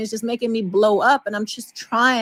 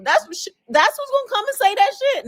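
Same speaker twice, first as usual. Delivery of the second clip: indignant outburst; 11 semitones higher than usual. Real, not acted. Outraged at mother's false claims.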